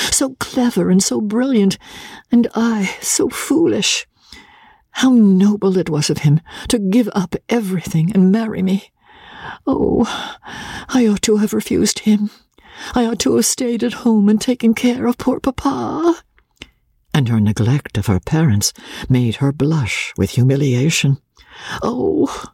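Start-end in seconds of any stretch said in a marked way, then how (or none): 0.1-16.2 high-pitched, plaintive lament; timid young wife, self-reproachful. A narrator's reading. Voices Emmy's anxious thoughts. 21.8-22.5 high-pitched, plaintive lament; timid young wife, self-reproachful. A narrator's reading. Voices Emmy's anxious thoughts.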